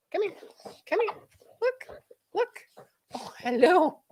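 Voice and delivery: high coaxing pet talk; high sing-song voice for the pet; dog-talk voice. Real, not acted. Talking to the dog.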